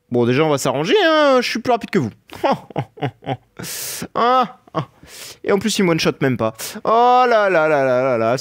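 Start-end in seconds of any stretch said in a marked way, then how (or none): 1.0-4.9 taunting. Pretend. Taunting boss after outspeeding. 6.8-8.4 delighted. Real, not acted. Delighted at the boss fight.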